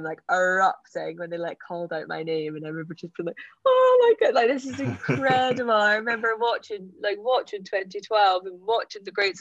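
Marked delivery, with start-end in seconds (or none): 3.6-5.7 much higher and louder, thrilled; awestruck. Retold or imitated. Hearing the stadium roar her name, awestruck. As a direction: burst out in wonder.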